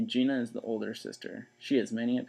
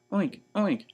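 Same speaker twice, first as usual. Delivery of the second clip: 1 playful pig oinks; 8 semitones higher, 3 dB louder than usual. Acted. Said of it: "said Mercy".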